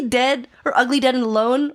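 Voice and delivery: ordinary delivery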